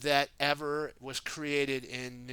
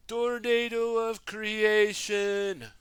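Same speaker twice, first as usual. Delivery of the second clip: high, slow, all-out battle yell; yelling as loud as possible; 7 semitones higher, 7 dB louder, slower than usual. Acted. Frustrated Jay calling the tornado to the ninjas, full yell. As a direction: stretch it out as loud as you can. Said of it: "yelled as loud as he could".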